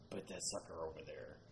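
low, soft imitated ghost voice; low harsh voice. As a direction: growl it low like the recording. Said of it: "a male voice saying".

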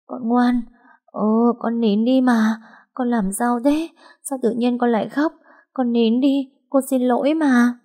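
panicky, pleading soothing; frightened pleading. Acted. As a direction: beg the baby to stop, scared. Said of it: "mếu máo muốn khóc theo".

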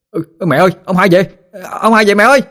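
very high, urgent call; excited calling out. Acted. Boy calls mother that grandpa is back, excited shouting. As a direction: call out high, climbing on each repeat. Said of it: "la làng la sớm lên".

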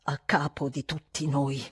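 slow, low and reluctant; dragged from the depths. A narrator's reading. Housekeeper reluctantly admits his power, words dragged from deep. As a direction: haul each word up from deep down. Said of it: "come se estraesse le parole ancor più dal profondo".

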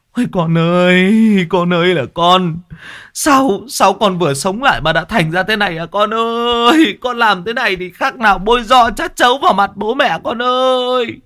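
high lamenting cry; wailing parent. A narrator's reading. Parents running after their possessed daughter, high wailing lament. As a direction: mourn the lost daughter. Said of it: "vừa chạy vừa mếu máo".

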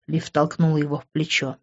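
usual speaking voice

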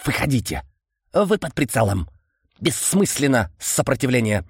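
strange clicking insect voice; chittering insect creature. Acted. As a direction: voice a buzzing bug-man. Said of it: "странный стрекочущий голос".

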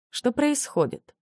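slow, high worried question; a touch of fear, slowly; anxious. A narrator's reading. Heroine's anxious inner question.

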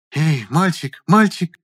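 high, rising call; woman's voice. A narrator's reading. Woman descending into cellar seeking boy, calling. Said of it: "Голос был женский".

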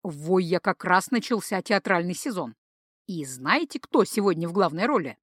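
ordinary delivery, nothing marked

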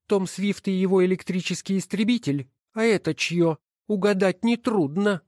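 high, scornful woman's voice; contemptuous. Acted. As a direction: sniff at the husband's book. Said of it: "презрительно фыркнув". (презрительно, высоким женским голосом)

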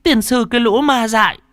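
put-on high, loud furious old woman; raging grandmother swearing at spirits; grandma cursing. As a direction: curse the ghosts away at full voice. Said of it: "bà vừa chửi".

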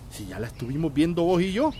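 ordinary delivery

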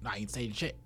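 low, soft Australian mate voice; lazy Australian brush-off, dropping away quietly; Australian accent impression. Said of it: "It's exactly like what he sounds".

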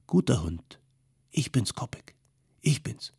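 hushed reassurance to a dog; soothing. A narrator's reading. Man calms a dog about to attack, soothing. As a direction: soften the voice, coax the dog gently.

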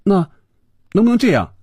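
high, tentative proposal; summoning courage. A narrator's reading. Woman finally daring to make a suggestion, summoning courage. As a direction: venture the request in a high voice. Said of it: "最后才鼓足勇气似的说".

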